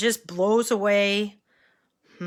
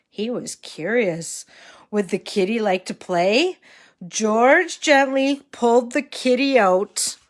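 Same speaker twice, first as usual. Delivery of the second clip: put-on reading to a toddler; 3 semitones higher than usual. A narrator's reading. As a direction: read it like to a small child. Reads story to children.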